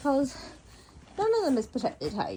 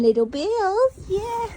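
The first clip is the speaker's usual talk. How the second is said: much higher cooing; sing-song pet voice; 11 semitones higher, 4 dB louder, slower than usual. Real, not acted. Cooing to horse.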